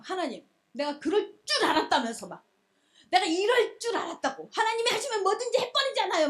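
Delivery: acted protest climbing in pitch; indignant I-knew-it grievance; angry Jonah. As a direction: complain to God in mock anger. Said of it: "막 이러면서 화를 내".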